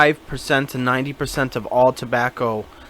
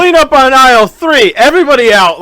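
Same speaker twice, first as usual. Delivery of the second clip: much higher, louder mock PA call; store announcement; 9 semitones higher, 7 dB louder than usual. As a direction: play the panicked store announcer.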